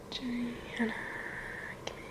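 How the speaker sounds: barely audible list of names; whispered recall. Real, not acted. Vlogger recalling who was on set, whispering to herself. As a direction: whisper to yourself while remembering.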